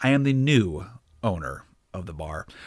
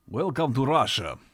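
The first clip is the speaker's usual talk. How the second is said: a lightly voiced Russian guide; thick Russian accent; 2 semitones higher than usual. A narrator's reading. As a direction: greet in a heavy Russian accent. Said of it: "said a man in a thick accent".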